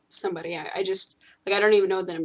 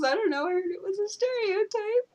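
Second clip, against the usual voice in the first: high mock friend voice; 13 semitones higher, 3 dB quieter than usual. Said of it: "And he goes".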